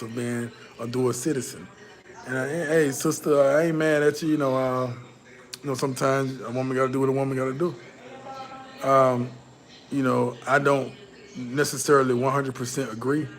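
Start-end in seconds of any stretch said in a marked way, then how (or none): none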